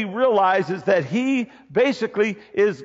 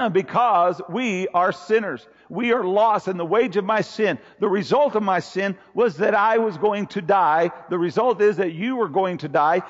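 usual speaking voice

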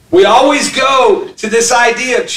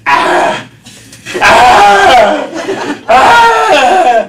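fake crying; 5 semitones higher, 5 dB louder, much slower than usual. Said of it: "he's like".

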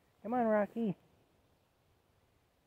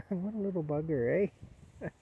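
ordinary delivery

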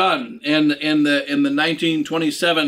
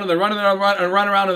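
mock runaround babble; much faster than usual. Mocking the runaround, rattled off.

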